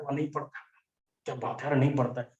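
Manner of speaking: low, soft, impressed murmur; mock cool admiration. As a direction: act quietly impressed by a slacker. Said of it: "cool वाला feeling आता है".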